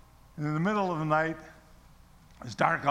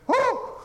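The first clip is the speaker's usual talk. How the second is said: high, loud "whoo"; retold cry of astonishment; startled whoop; 6 dB louder than usual. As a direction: let out a short high whoop. Retold reaction to the voice.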